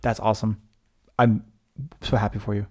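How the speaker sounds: dry sarcastic praise. Acted. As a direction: say it like you don't mean it. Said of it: "I sound so sarcastic".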